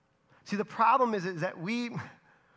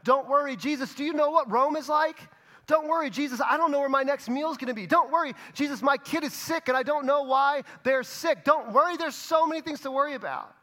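higher, louder mock-complaining crowd voices; anxious crowd; 8 semitones higher, 4 dB louder than usual. Crowd murmuring back to Jesus, higher and louder complaining. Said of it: "a murmur in the crowd".

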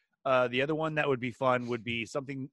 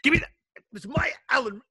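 mock squabble; 14 semitones higher, 4 dB louder than usual. Mock grabbing the tool.

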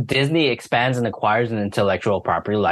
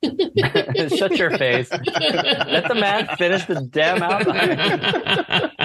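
laughing mock scold; 6 semitones higher, much slower than usual. Real, not acted.

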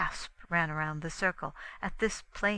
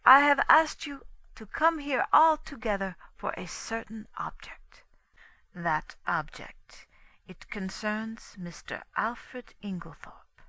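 lightly voiced Poirot addressing an audience; lightly voiced lecturer; 5 semitones higher, 4 dB louder than usual. A narrator's reading. Poirot to those he has gathered, lightly lecturing. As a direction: address the room with a touch of ceremony. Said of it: "about to deliver a lecture".

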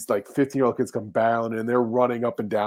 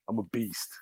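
low, soft mock tough-kid voice; teen boasting; 3 semitones lower, 12 dB quieter than usual. Teen bragging to the dad at the park, low mock tough. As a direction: growl it like a cocky kid. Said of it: "He's like".

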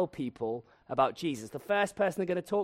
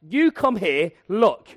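exasperated user; 3 semitones higher, 7 dB louder than usual. Retold or imitated. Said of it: "It's like".

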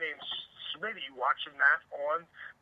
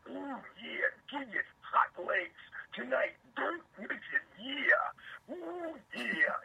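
high, breathy late-night ad read; sultry radio ad voice; 10 semitones higher, much slower than usual. Retold or imitated. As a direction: purr it like a sexy radio promo. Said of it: "I might go".

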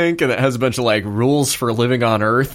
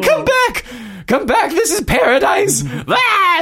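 desperate calling. Acted. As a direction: shout after the closing portal. Villain stranded calling after them.

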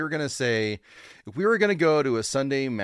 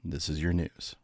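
announcer intro. Acted. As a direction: announce the show opening.

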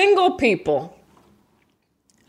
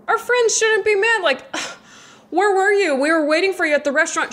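whiny friend; 10 semitones higher, faster than usual. Acted. Friend whining about missed dinner.

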